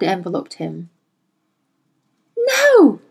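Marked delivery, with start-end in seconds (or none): 2.4-3.0 high, loud cry of protest; high anguished wail. A narrator's reading. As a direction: cry out in desperate horror. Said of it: "she cried".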